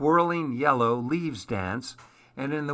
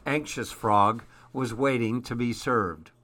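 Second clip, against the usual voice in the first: ordinary delivery, nothing marked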